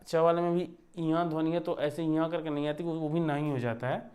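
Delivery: ordinary talk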